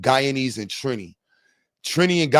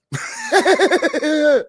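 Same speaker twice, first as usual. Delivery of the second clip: high-pitched cackling laugh; helpless high laughter; 13 semitones higher than usual. Real, not acted. Streamer laughing off getting canceled, high laugh.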